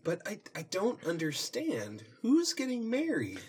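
high startled question; confused surprise. Acted. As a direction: ask it baffled. Twilight confused.

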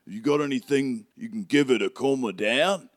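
concerned helper, rising into a question; Hagrid. Acted. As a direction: search for a tactful word in a put-on accent. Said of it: "And then he goes".

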